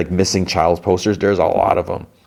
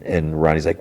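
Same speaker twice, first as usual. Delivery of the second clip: ordinary delivery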